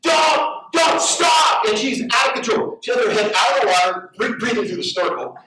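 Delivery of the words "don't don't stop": imitating yelling crowd. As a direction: call out urgently like a worried crowd. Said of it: "all people with her going".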